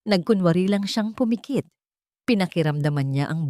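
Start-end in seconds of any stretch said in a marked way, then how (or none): none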